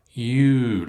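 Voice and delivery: "You" said as a long oo with a Y glide. Shown as a demonstration. Teacher showing mouth shape on "You" for learners. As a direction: stretch the "You" with wide lip rounding. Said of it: "look at the mouth difference".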